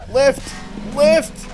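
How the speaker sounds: high, loud short calls; urgent calling out. Real, not acted. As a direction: call out urgently to a teammate. Calling to teammates for a lift.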